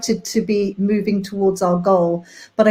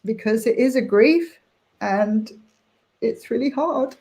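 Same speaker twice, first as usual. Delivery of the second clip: tearful. Real, not acted. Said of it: "so I'm gonna get emotional".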